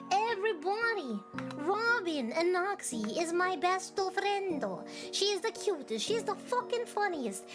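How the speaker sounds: excited shout-out. Acted. Shout-out in high voice.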